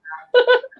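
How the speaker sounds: high-pitched burst of laughter. Real, not acted. Laughing at lowball offers.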